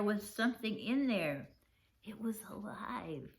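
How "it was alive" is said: soft, hushed wonder at the find; softly awestruck; awed hush. A narrator's reading. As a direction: breathe it in quiet amazement. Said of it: "I couldn't believe my eyes".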